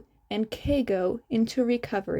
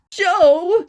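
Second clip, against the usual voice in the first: loud alarmed call of his name; startled shout; 6 semitones higher, 9 dB louder than usual. Acted. Calls out to fallen Shota.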